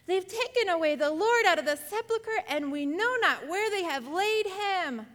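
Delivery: voicing Mary in alarm; Mary's distress. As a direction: cry it out as a distraught woman. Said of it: "and said unto them".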